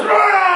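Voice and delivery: loud high beast roar. Acted. Kong roars at the crowd in the gate, loud roar. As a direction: roar like a giant ape. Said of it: "He roars".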